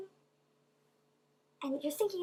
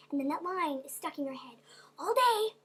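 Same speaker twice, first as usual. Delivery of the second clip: usual speaking voice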